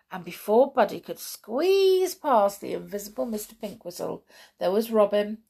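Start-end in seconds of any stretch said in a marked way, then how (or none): none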